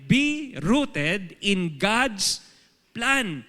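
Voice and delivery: each word drawn out very slowly; slow title announcement. Shown as a demonstration. Preacher announcing the sermon title, very slow and deliberate. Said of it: "yung title po natin".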